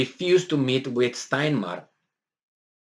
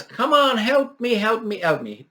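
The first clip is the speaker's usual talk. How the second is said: higher-pitched cry for help; imitated yelling, pitched up; mock yell; 9 semitones higher than usual. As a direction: call out urgently for help, acting it out. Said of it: "He yells".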